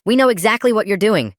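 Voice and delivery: high, loud strained anger through gritted teeth. Retold or imitated. Dad telling his son he sees through the trick, through gritted teeth. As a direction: force it out through clenched teeth. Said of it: "Dad, through gritted teeth".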